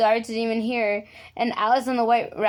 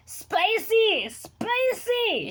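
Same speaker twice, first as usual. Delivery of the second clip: much higher, frantic burning cry; yelling spicy; 12 semitones higher, much slower than usual. A narrator's reading. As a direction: yelp as if your mouth is on fire. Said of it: "she yelled".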